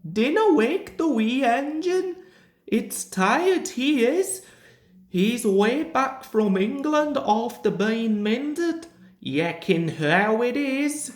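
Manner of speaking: a kindly Scottish engine keeping his voice down; Scottish engine Donald. A narrator's reading. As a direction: narrator voicing Donald gently.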